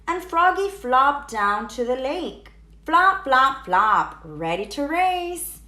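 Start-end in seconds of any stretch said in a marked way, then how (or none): none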